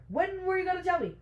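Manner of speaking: exasperated, high-pitched acted demand; escalating indignation. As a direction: push the demand right up in pitch. Repeats the confrontation, escalating.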